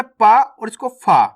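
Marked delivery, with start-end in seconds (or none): 0.0-0.4 पा with lips popping open, no puff; plain P sound. Shown as a demonstration. Teacher recaps two letters for learners, पा kept unaspirated. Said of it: "इसको कैसे पढ़ा अपन ने". 1.0-1.2 फा with air blown through the lips; airy F sound. Shown as a demonstration. Teacher recaps two letters for learners, फा with airy friction. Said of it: "इसको कैसे पढ़ा अपन ने".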